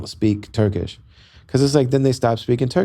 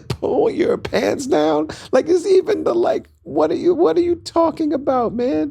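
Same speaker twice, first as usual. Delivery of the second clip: incredulous squeal. Retold or imitated. Repeating the doctor's order, high incredulous squeal. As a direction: squeak it out in total shock.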